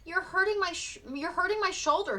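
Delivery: high, pained plea; boy begs to be let go; cried in pain. A narrator's reading. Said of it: "he cried".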